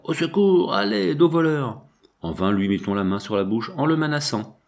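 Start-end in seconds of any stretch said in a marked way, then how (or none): 0.1-1.8 high-pitched call for help; cry for help. Acted. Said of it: "L'abbé cria". (appel au secours, voix montée dans l'aigu)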